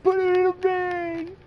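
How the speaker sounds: very high, stretched-out strained cry; hammed-up desperate squeal, loud and slow; frantic Barney impression. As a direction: imitate a cartoon drunk losing it. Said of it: "He's like".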